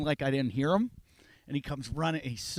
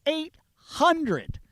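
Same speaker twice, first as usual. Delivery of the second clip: much higher and louder indignant reveal; telling friends the fine grew tenfold; outraged; 13 semitones higher, 6 dB louder, much slower than usual. Real, not acted. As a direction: hit the eight hundred in disbelief.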